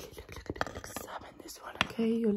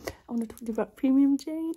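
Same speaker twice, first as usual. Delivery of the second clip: suddenly loud; 3 semitones higher, 9 dB louder, faster than usual. Pretend. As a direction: whisper dropped for a loud normal voice. Breaks whisper as a joke.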